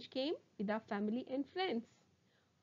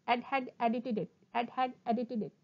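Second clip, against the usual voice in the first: quick, joined-up repeats; sped-up tongue twister; faster than usual. Shown as a demonstration. Teacher shows the twister at speed, quickly. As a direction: rattle it off twice. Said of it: "speed up".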